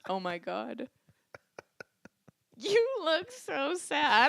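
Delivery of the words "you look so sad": mock pity. Teasing pity over photo.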